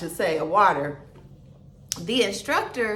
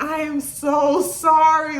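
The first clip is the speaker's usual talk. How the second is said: drawn-out apology; 6 semitones higher, 5 dB louder, slower than usual. Retold or imitated. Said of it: "I was like".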